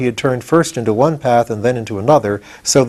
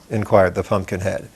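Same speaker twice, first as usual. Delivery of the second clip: plain reading voice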